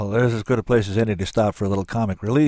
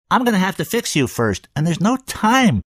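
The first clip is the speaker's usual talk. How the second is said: high cartoon voice; 9 semitones higher than usual. A narrator's reading. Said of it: "character he sounded like".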